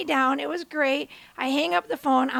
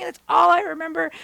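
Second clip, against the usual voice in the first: ordinary delivery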